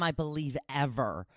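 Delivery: ordinary delivery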